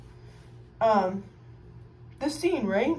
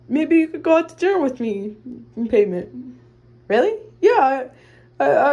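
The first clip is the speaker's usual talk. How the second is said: ordinary delivery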